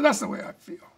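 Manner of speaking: a retold blunt, defiant answer; mildly heated final word; curt retort. His curt reply in quarrel.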